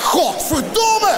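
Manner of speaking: high drawn-out shouted curse; furious curse. Retold or imitated. Comedian swears at the doctor on the phone, furious shout. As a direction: explode with the swear. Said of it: "Ik zeg".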